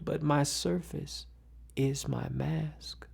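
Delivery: ordinary talk, nothing marked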